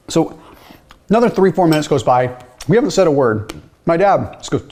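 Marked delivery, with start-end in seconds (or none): none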